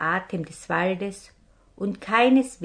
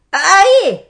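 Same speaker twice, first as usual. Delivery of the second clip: much higher, much louder pained yelp; bellowed yelp; 12 semitones higher, 15 dB louder than usual. Acted. Captain yells out as the purse lands on him. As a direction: yell as the purse hits your belly. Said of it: "brüllte der Räuber auf".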